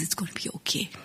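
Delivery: hushed, gentle reassurance; soothing whisper. Retold or imitated. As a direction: whisper it like a mother soothing a crying child. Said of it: "And she says".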